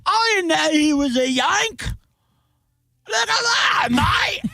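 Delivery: very high, wobbly mock-British voice; hammed-up British accent. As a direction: squeal it in a bad British-Australian accent. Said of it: "and they're like".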